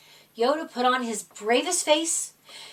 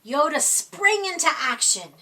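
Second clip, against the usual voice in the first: ordinary delivery, nothing marked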